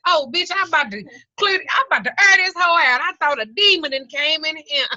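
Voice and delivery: high, loud freaked-out holler; high panicked holler at the chihuahua; retold hollering. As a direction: yell at the dog in a panic. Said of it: "I was hollering".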